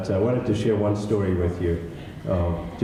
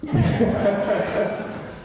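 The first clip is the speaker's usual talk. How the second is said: high laugh; 12 semitones higher than usual. Real, not acted. Laughing at a remark.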